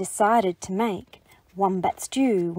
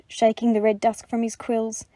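plain reading voice

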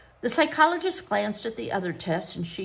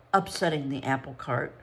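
ordinary delivery

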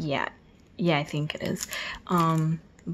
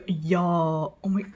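usual speaking voice

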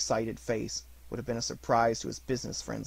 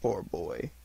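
pitying; 4 semitones lower than usual. A narrator's reading. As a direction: grieve for the lonely child. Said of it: "in pity for his former self".

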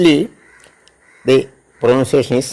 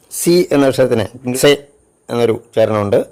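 ordinary talk

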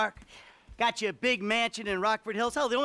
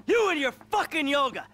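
mocking snarl; 8 semitones higher, 3 dB louder than usual. Marital quarrel over yoga.

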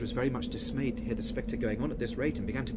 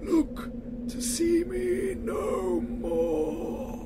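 high, slow, loud moaning spectre; wailing ghost; 11 semitones higher, 5 dB louder, slower than usual. Acted. Marley's ghost wails at Scrooge, slow and loud. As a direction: drag each word out like a wail from the grave.

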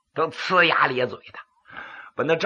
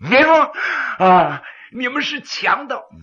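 frightened cry; 6 semitones higher, 5 dB louder than usual. Acted. Frightened magistrate cries out.

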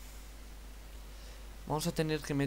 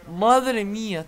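amazed; 5 semitones higher, 9 dB louder, much slower than usual. Real, not acted. Amazed by the save.

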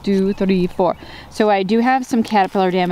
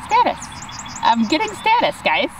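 excited; 7 semitones higher than usual. Real, not acted. Showing off big plants.

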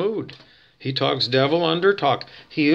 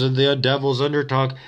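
usual speaking voice

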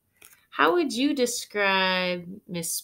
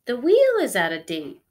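whooping; 3 semitones higher, 8 dB louder, faster than usual. Acted. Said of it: "whooped Holly".

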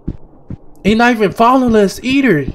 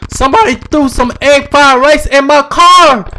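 higher-pitched, outraged emergency report; indignant complaint; 6 semitones higher, 4 dB louder than usual. Pretend. Gamer complains to the emergency operator, worked-up and indignant. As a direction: build indignantly to the last word.

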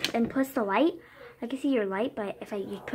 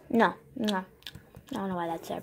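ordinary talk, nothing marked

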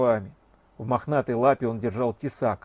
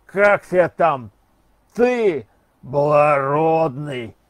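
put-on drawn-out drunken roar, louder; hoarse bellow; 7 dB louder, much slower than usual. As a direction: bellow slowly at the passing rider like a hungover trooper. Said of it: "сипло взревел".

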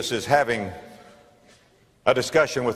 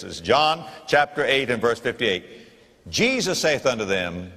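ordinary delivery

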